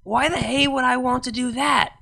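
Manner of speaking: shocked and accusing. Acted. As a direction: throw it back at her as an accusation. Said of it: "the tone of accusation in her voice".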